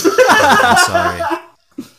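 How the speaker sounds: high, loud, laughing yelp of apology; startled squeak. Acted. Spider-man [name] squeaks an apology after startling Stevie. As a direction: jump and squeak out a sorry.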